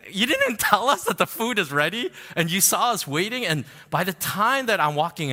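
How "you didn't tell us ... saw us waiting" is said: retold indignant complaint. Retold complaint to restaurant staff.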